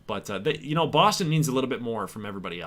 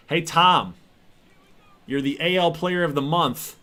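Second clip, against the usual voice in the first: higher, louder mock heckling that builds to a shout; roasting trash talk; 5 semitones higher, 6 dB louder than usual. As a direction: roast the hitter, getting louder each line. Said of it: "Let's roast Tom Cloutier".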